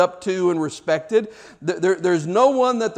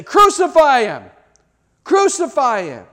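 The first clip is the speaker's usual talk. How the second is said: high, loud mob cry; crowd shouting; 7 semitones higher, 6 dB louder than usual. Retold or imitated. Crowd demanding Jesus be crucified, shouting. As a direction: hurl it like a furious crowd. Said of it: "And they say".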